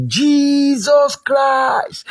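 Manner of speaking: high, very slow calling cry; drawn-out shout. Shown as a demonstration. Preacher showing the congregation how to shout, drawn-out cry. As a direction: stretch the name out as a high call. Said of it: "shout it very well".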